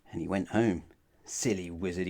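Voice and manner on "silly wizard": muttered. Acted. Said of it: "he said again".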